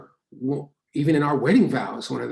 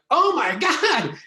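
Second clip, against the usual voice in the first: much higher, slower gasp of recognition; starstruck fans; 7 dB louder, slower than usual. Retold or imitated. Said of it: "they go, like".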